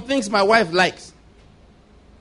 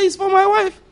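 high protest; 11 semitones higher than usual. Retold or imitated. Man explaining himself.

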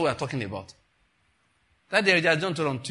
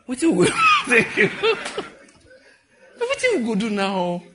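laughing mock shout; 8 semitones higher, 6 dB louder than usual. Mocking God's reaction, laughing.

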